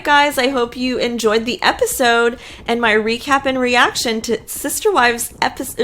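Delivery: ordinary delivery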